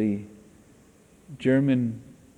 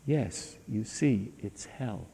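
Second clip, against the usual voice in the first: resigned sigh; 2 semitones lower, 7 dB quieter, slower than usual. Retold or imitated. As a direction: let it sink in hopeless defeat. Hitler resigned, final line.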